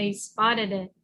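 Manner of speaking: ordinary delivery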